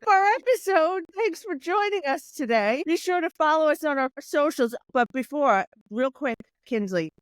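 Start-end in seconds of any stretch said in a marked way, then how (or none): 0.1-4.7 upbeat host sign-off voice; podcast outro. Acted. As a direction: wrap up the show brightly. Host signs off the episode.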